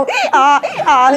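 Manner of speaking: high, loud imitated donkey bray. Diner afraid to burp after street food, braying like a donkey. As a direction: burp out a mock hee-haw. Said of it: "it tastes like a donkey".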